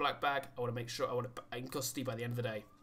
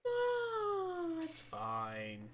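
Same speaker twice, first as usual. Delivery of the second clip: mock-cheery; much slower than usual. Ironic after officer hurt.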